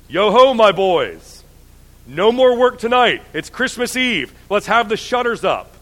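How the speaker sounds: put-on hearty, loud jolly old boss calling out; jovial call. A narrator's reading. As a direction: boom it out like a jolly master. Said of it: "called out in a comfortable, oily, rich, fat, jovial voice".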